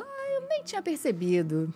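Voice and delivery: high, slow gracious diva voice; a light imitation of a gracious diva; diva airs. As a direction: drawl it out like a gracious star. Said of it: "a diva, né?".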